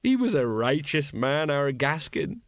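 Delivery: slow, maudlin eulogy; sad. A narrator's reading. Tipsy sergeant mourning his comrade Gaskin, sadly. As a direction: praise him slowly and sadly. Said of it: "said Colon sadly".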